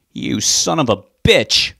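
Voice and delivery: slow, loud furious yell. A narrator's reading. Rob yelling at his father, slow and furious. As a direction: hurl it at him as you move. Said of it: "Rob yelled at him".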